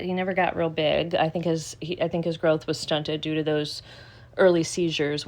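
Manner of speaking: usual speaking voice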